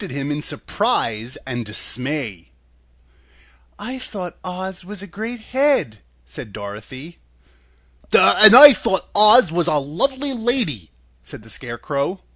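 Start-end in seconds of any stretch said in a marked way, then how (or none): 3.8-6.0 put-on higher, slower girl's voice; lightly voiced girlish disappointment; young heroine Dorothy. A narrator's reading. 8.1-10.9 loud, higher dopey voice with a "duh"; loud, goofy straw-man voice; dim Scarecrow. A narrator's reading.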